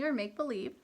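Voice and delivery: normal talking voice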